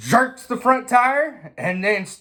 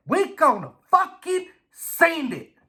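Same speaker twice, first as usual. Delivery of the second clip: high, cocky hyped-up voice; idol's voice; 10 semitones higher than usual. Retold or imitated. Idol Larry egging the racer on, high and cocky. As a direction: sell it like a wild hype man. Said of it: "He said".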